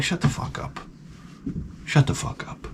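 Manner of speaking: very soft, dry aside; grumbling retort. Retold or imitated. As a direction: mutter it under the cheering. Grumbles back at cheering people.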